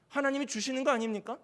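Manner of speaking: ordinary talk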